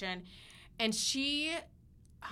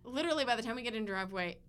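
ordinary talk, nothing marked

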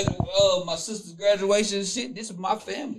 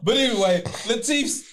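normal talking voice

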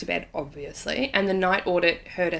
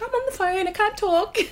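pitched-up brushing-off quote; high dismissive self; 14 semitones higher than usual. Retold or imitated. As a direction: toss it off high and breezy. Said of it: "And, and I'm like".

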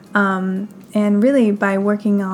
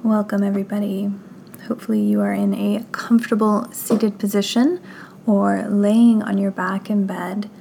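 guided meditation; 5 dB quieter than usual. Acted. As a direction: guide them gently through breath and body, unhurried.

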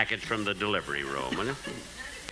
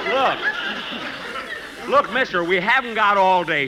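loud, high impatient scolding; 6 semitones higher, 4 dB louder than usual. Acted. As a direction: Jack fed up with the clerk's stalling. Jack losing patience.

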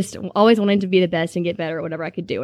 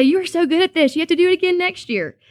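much higher, gushing praise; praising parents; 9 semitones higher than usual. Retold or imitated. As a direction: fellow parents begging her to do it again. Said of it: "She had people say".

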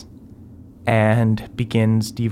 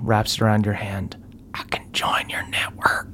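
whispering; 4 dB quieter than usual. Acted.

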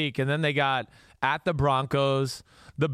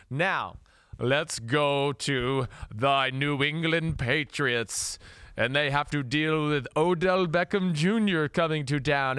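Captain Andrew Luck vampire voice; 4 semitones higher than usual. Acted. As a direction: ham up a British vampire count. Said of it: "slipping into your Captain Andrew Luck British vampire voice".

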